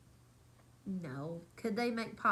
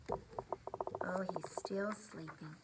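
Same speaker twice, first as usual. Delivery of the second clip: hushed whisper, much softer; 4 semitones lower, 9 dB quieter, much slower than usual. A narrator's reading. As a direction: whisper so the bear stays asleep. Hushed aside about sleeping bear.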